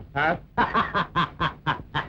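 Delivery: low gloating villain chuckle; scornful villain laugh; mocking laugh. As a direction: laugh her prayer off low and smug. Villain mocks the woman.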